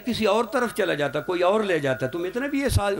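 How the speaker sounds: high, hushed scolding wife's voice. Retold or imitated. Wife repeats her scolding.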